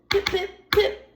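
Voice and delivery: squeaky little pip pip pip; high chick peeps. Acted. New brother peeping out of his shell, high squeak. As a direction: peep like a newborn duckling.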